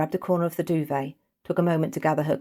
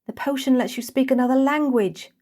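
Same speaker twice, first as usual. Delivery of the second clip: panicked explaining; 9 semitones higher, 4 dB louder than usual. Acted.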